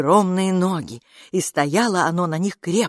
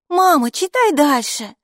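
eager; 10 semitones higher, 5 dB louder than usual. A narrator's reading. Kids urge mom to read.

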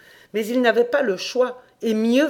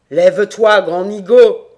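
very loud, scornful command; booming devil's rebuke; barked order; 12 dB louder than usual. A narrator's reading. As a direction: bark at a cowering servant. Devil orders the cowering captive.

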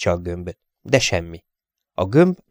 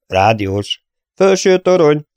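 loud, tense report; slightly shaky callout; 7 semitones higher, 5 dB louder than usual. A narrator's reading. Engineer reporting in after silence, slightly shaky. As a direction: report in with a tremor of fear. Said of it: "Kicsit remegett a hangja".

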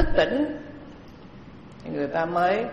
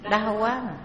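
imitated groaning; 5 semitones higher than usual. Cancer patients still groaning after taking medicine, imitated moaning. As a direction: lightly act out a patient's complaint. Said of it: "nhưng mà cũng rên la".

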